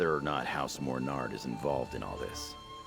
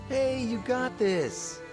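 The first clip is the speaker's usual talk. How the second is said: upbeat cheer; much slower than usual. Acted. Cheering the player on.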